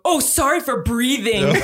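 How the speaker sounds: slow, drawn-out mock offence; mad sarcastic apology, imitated. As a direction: imitate a sleeper huffily apologising, stretching it out. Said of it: "He was so mad at me".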